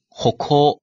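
歩行 read as Japanese "hokou", the 歩 as "ho"; 歩行 said the Japanese way, "ho-kou". Shown as a demonstration. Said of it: "一般读作ほ".

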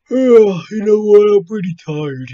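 hammed-up weary drunk, high and loud; tired shopper. Acting out a man announcing he will nap in Walmart, mock tired. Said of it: "he just walked into Walmart and was like".

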